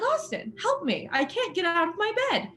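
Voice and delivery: high, pleading cry for help; high-pitched plea to Austin; pleading call. Retold or imitated. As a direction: beg the roommate to help. Calling roommate for help.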